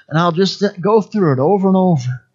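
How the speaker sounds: usual speaking voice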